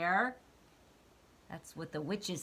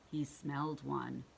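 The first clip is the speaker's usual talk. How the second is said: ordinary delivery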